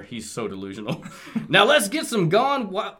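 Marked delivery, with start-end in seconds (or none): 1.5-2.9 Blaster voice. Acted. Voicing Blaster.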